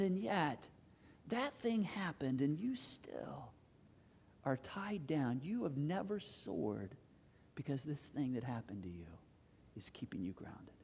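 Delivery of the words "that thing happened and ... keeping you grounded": slow, soft, sinking sermon voice; quiet, low, weighty delivery; hushed preaching. Real, not acted. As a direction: drop to a grave hush with long pauses. Sermon drops to a hush.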